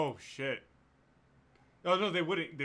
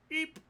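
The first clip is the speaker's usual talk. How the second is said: tiny high squeak of alarm; short, startled creature squeak; startled kobold eep; 4 dB louder than usual. Acted. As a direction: let out a little eep. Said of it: "you just hear him go".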